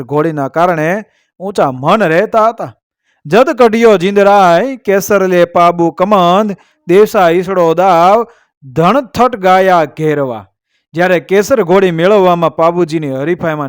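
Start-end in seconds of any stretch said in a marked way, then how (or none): 3.3-10.5 measured, metrical couplet delivery; verse recitation. A narrator's reading. Storyteller reciting a couplet to listeners, verse recitation. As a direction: recite it as an old heroic verse.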